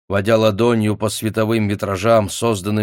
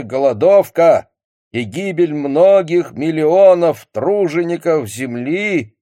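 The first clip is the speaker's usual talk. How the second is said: loud, slow, high solemn radio speech; Marshal Voroshilov; 8 semitones higher, 5 dB louder than usual. Acted. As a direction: boom it out like a Soviet broadcast. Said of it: "торжественный голос маршала Ворошилова".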